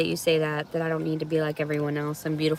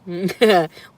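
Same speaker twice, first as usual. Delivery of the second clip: loud laugh; 7 dB louder than usual. Real, not acted. Laughs at chat.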